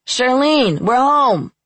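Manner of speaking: high, loud call from another room; shouting. A narrator's reading. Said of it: "heard shouted by Sniff".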